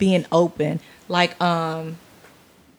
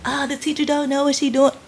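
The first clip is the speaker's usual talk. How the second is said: put-on complaining kid; 7 semitones higher than usual. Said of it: "there will be times where".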